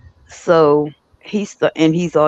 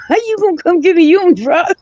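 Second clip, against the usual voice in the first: high, loud incredulous mock voice; mocking high relatives; 4 dB louder, faster than usual. Retelling relatives mocking her offer, high scoffing voice. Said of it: "they be looking like".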